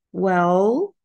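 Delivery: "well" stressed and stretched out, rising; drawn-out starter. Shown as a demonstration. Teacher showing learners the conversation starter, drawn-out "well". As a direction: linger on "well" with a lift at the end. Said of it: "هتلاقوا عليها stress كده وبيطولوها".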